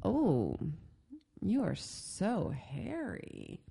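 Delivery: slow, soft, quiet admiring tone. Retold or imitated. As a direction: linger on it fondly. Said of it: "here's the context".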